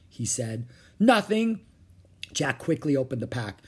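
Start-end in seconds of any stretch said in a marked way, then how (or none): 1.0-1.6 high, loud, hasty denial; nervous denial. Acted. Jack to the pirate about his pack, nervous denial. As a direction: blurt it defensively.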